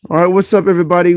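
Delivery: loud upbeat show opener; energetic intro. Acted. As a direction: greet the audience with energy. Podcast intro greeting.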